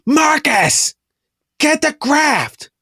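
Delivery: shouting to the back. Acted. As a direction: yell for Marcus in the back room, high and drawn out. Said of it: "She goes".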